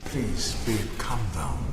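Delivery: soft unvoiced hush to calm her; whispered soothing. Husband calms his angry wife in a whisper. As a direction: whisper gently to settle her down.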